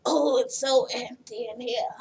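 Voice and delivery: rough, half-voiced smoker impression; Big Ange. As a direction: strain for a husky smoker's croak, badly faked. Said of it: "I can't do it".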